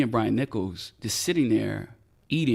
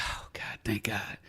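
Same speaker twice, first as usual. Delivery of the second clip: hushed, breath-out relief; relieved whisper; 9 dB quieter, slower than usual. Retold or imitated. Officer relieved lieutenant caught on, relieved whisper. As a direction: whisper your thanks to yourself.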